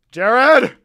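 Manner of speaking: high, very loud name call; explosive, high-pitched yell; sudden shout. Pretend. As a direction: holler the name out.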